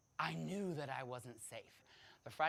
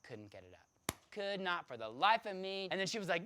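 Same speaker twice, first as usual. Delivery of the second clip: ordinary delivery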